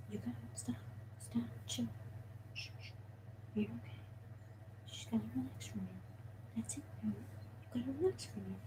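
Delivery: very soft, near-whispered calming; barely audible, coaxing murmur; soothing whisper. Real, not acted. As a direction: hush a restless pet under your breath. Hushing and soothing the snake.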